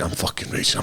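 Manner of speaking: ordinary talk, nothing marked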